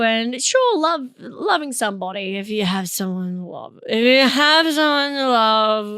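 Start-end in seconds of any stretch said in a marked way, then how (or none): 3.9-5.9 loud, drawn-out mock-bitter repeat; slow, strained comic resentment; mock bitterness. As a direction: stretch it out loudly, as if it stings. Bitter repeat on Valentine's Day.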